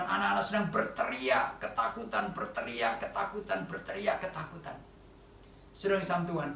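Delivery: ordinary talk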